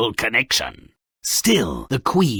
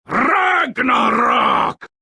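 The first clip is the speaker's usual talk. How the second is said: drawn-out slow triumphant cry, higher; gleeful shout; 10 semitones higher, 4 dB louder, much slower than usual. Acted. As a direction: roar it out like a prophecy come true. Gleeful cry of world's end.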